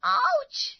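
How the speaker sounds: loud, high ouch; yelp of pain. Acted. Payal as a heavy pot hits her foot, pained yelp. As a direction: cry out sharply in pain.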